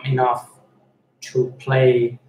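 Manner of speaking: ordinary talk, nothing marked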